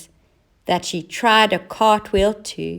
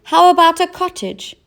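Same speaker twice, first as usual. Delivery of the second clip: high, loud guessing cat; cat offering a new idea; eager guess; 10 semitones higher, 7 dB louder than usual. A narrator's reading.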